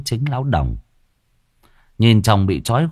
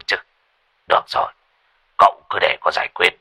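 boastful; 4 semitones lower than usual. A narrator's reading. Boastful sorcerer brushing off danger.

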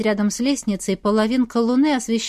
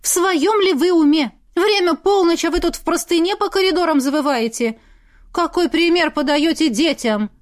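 higher, louder outraged lecture; indignant scolding; 7 semitones higher, 3 dB louder than usual. A narrator's reading. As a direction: tell off a grown-up like a child. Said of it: "в возмущении".

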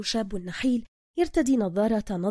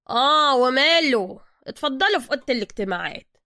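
higher, louder workshop boss; gruff master mechanic; 4 semitones higher, 7 dB louder than usual. Acted. Zergina greeting Soka in his workshop, higher and louder. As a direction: greet him like you own the place.

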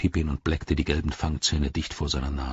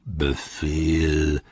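low slow growling orc; deep growl. A narrator's reading. Orc leader cutting off the squabble, deep growl. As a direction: growl the single word like a commander. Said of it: "eine tiefe Knurrstimme".

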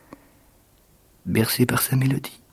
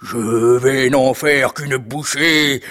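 slow, drawn-out ogre threat; menacing ogre; 3 semitones higher, 4 dB louder, much slower than usual. Acted. The ogre vows to devour the intruder, menacing giant voice. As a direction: stretch it out like a hungry giant.

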